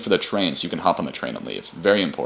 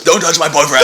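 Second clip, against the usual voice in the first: higher, louder possessive shout; jealous yell; 7 semitones higher, 7 dB louder than usual. Retold or imitated. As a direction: scream it like a jealous madman. Leo shouting in retold scene.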